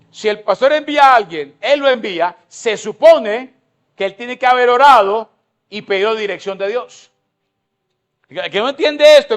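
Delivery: fervent sermon at full voice; full-force preaching. Acted. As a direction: drive the point home to the congregation.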